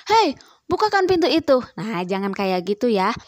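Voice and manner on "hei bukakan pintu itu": higher, louder and fast, a rude bossy "Hei"; snapping command. Shown as a demonstration. Teacher demonstrating the rude way to order, snapping. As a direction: bark the order at them. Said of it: "jangan sambil membentak".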